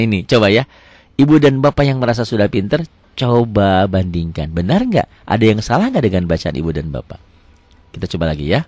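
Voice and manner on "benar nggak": ordinary talk, nothing marked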